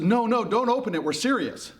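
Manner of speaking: high, insistent crowd warning; urgent plea. Acted. Everyone warning Pandora off the box, urgently.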